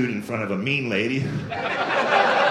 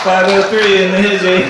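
loud, hyped call to the crowd; big brash shout-out; hometown shout-out; 4 semitones higher, 10 dB louder than usual. Real, not acted. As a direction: hype up the home crowd.

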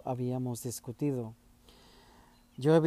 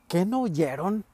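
father's loud, higher angry shout; 6 semitones higher, 5 dB louder than usual. A narrator's reading. Father yelling at his family over the plan, loud shout. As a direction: bark the question at the family. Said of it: "gritó papá".

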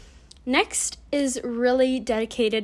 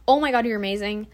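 ordinary talk

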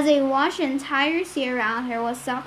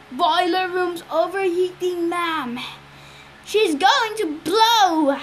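urgent sailor; 5 semitones higher, 3 dB louder than usual. A narrator's reading. Zombie sailor reports, second take.

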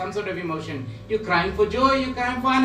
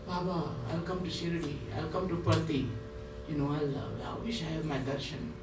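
longing devotee prayer; 4 semitones lower, 12 dB quieter than usual. Retold or imitated.

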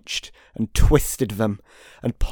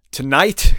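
louder, rising cry of alarm; 2 semitones higher, 7 dB louder than usual. A narrator's reading. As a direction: cry out in shock. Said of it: "cried Scrooge".

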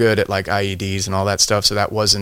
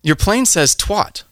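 high, slow, stunned reading of the sign; incredulous disbelief; 9 semitones higher, much slower than usual. Retold or imitated. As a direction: drag it out in disbelief. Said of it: "and I'm like".

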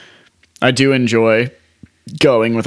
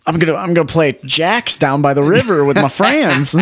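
put-on wholesome 1950s kid; 6 semitones higher than usual. Old-timey kid jokes.

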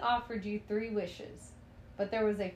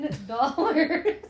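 slow, louder, laughing "billion dollars"; laughing emphasis; 5 semitones higher, 10 dB louder, much slower than usual. Real, not acted. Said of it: "With a B".

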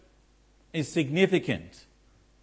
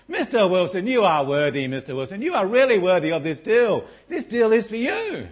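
higher, louder put-on Indian accent; mock call centre accent; 6 semitones higher, 5 dB louder than usual. Preacher plays the salesman pushing the deal in a put-on accent. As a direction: push the deal in a hammed-up accent. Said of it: "work with the accent anyway".

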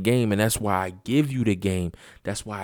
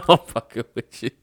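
laughing through the words; playful teasing, breaking into laughter. Real, not acted. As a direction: crack up while saying it. Laughs after joking outburst.

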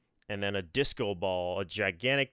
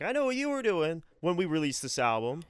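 [name]; 8 semitones higher than usual. Retold or imitated. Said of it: "he's like".

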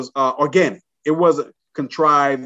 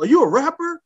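retold yell; 10 semitones higher than usual. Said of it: "somebody else yelled".

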